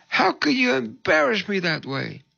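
imitated high-pitched indignation; hammed-up wounded accusation, high voice; livid. Upset woman accusing the pastor of embarrassing her, mock outrage. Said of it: "She was livid".

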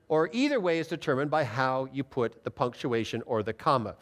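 ordinary delivery

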